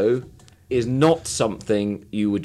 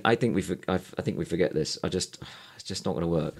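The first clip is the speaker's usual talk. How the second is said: tired and despondent; 6 semitones lower, 10 dB quieter than usual. Acted. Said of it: "You seem tired and just a bit despondent".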